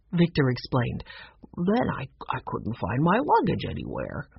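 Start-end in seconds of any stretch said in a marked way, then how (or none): 1.6-4.3 hesitant, lower mumbled confession; absent-minded conductor. A narrator's reading. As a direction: trail through it, lightly befuddled.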